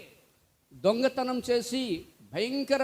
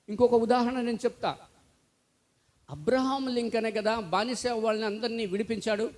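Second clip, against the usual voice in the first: ordinary talk, nothing marked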